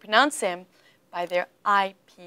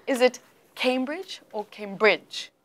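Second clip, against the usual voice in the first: "Cambridge" slowed, stress first on CAM then moved; slow contrast; 2 semitones higher, slower than usual. Shown as a demonstration. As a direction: ask slowly which syllable is stressed. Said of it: "Listen carefully".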